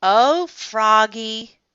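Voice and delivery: slow, loud, mildly exasperated; sighing. A narrator's reading. Mom hearing about the bathing suit, sighing. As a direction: draw out both words like a sigh. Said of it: "Mom sighed".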